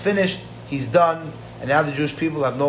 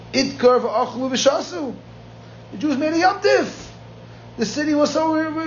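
jubilant story narration; 8 semitones higher than usual. A narrator's reading. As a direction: tell of the rejoicing in a much higher voice.

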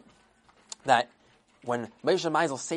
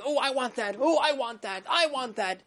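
higher, whiny craving voice; greedy Yetzer Hara; 6 semitones higher than usual. Acted. The Yetzer Hara craving things, a higher whiny wanting voice. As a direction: whine like a child grabbing for candy. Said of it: "the Yetzer Hara sees something and says".

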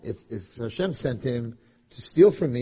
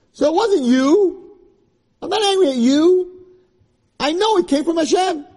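much higher and louder, fervent; fervent preaching; 12 semitones higher, 6 dB louder than usual. Acted. Yosef to his brothers, preached with fervor. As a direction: proclaim it like a preacher.

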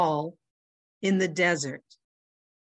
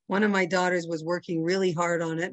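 usual speaking voice

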